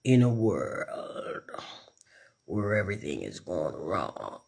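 slow, deep movie-trailer narrator; apocalyptic intro voice. Shown as a demonstration. Streamer demonstrating a film intro, deep apocalyptic voice. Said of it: "we can do the apocalyptic intro voice there".

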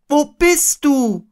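high, drawn-out loud call; crying out. A narrator's reading. Boy calling for Pooh, high loud slow cry. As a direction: call far up for a lost friend. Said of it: "schrie Christopher Robin".

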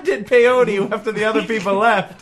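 high, loud put-on voice, close to laughing; flustered shopkeeper. Shopkeeper admitting to peyote, high comic voice. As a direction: excuse yourself loudly in a high character voice. Shopkeeper makes a confused excuse.

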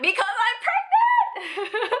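very high, slow giggly announcement; high slow laughing "pregnant" reveal. Retold or imitated. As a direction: drag it out, laughing with joy. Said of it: "And I'm like".

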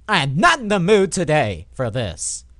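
usual speaking voice

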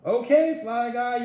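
loud, high yell to the hidden fly; boy giving up the game, yelling upward. Acted. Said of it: "yelled Buzz".

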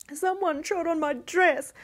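a startled princess close to crying; almost in tears. A narrator's reading. Youngest princess cries out on the dark stairs, near tears. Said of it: "she cried, almost in tears".

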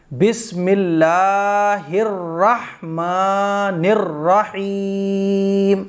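slow, drawn-out chanted recitation; Quranic recitation. Shown as a demonstration. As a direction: recite it in full tajweed. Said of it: "یہ ہے صحیح".

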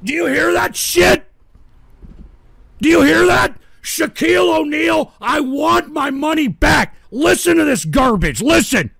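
high, loud outraged complaint; angry yelling. Real, not acted. Man showing listeners his creaky chair, outraged shouting. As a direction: yell it so they all hear.

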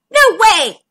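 high, loud refusal; indignant little crab cry. A narrator's reading. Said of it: "cried the crabs".

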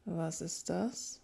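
slow, drawn-out suspicious question. A narrator's reading. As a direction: ask it slowly, with wary doubt. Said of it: "roch misstrauisch an dem Getränk".

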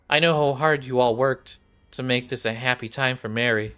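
ordinary delivery

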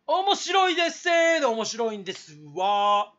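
声を張り、語尾を伸ばす; 高く大きく言い切る; 大げさな自画自賛. Acted. 自画自賛を大げさに連呼. (わざとらしく声高に自慢)

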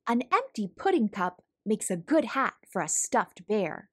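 ordinary delivery

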